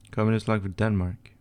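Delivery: ordinary delivery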